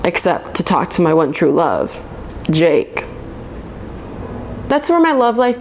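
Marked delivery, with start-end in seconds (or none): none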